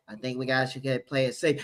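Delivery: soft, meek mock coach voice; timid coach. A hint of a cautious coach suggesting playing it safe. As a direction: mumble it like a scared play-caller. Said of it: "Cohen and Bowles be like".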